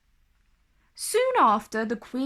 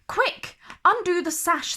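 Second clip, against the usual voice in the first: much higher, urgent dwarfs; crying out; 7 semitones higher, 3 dB louder than usual. Acted. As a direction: shout in alarm. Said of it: "they cried".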